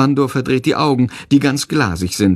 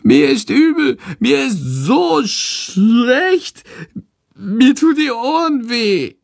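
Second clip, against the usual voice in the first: high, drawn-out bat whine; wailing; 11 semitones higher, slower than usual.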